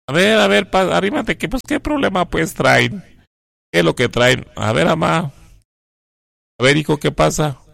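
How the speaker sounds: a mother pressing the two men for what happened; mother demanding answers. Retold or imitated. Mamá exige explicación, voz alta. (mamá exigente, con la voz más aguda)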